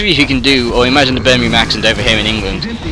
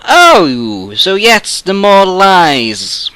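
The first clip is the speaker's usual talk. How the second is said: higher, louder, drawn-out sarcastic "oh"; mock realisation; 9 semitones higher, 5 dB louder, much slower than usual. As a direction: stretch it out in scornful mock surprise. Acting out his reaction to lies.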